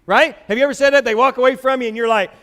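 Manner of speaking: normal talking voice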